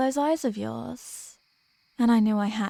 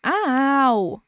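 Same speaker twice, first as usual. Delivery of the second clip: short theatrical ow, a bit louder; mock pained yelp; 2 semitones higher, 5 dB louder than usual. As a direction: yelp as if lightly swatted. Reacting to being hit.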